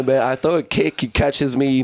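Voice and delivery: usual speaking voice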